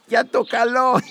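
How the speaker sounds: usual speaking voice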